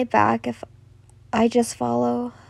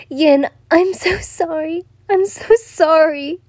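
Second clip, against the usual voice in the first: slow, high, mildly tearful plea; sobbing apology; 6 semitones higher than usual. A narrator's reading. She apologizes in his arms, mildly sobbing. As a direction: draw out the apology, pitched up. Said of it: "she sobbed".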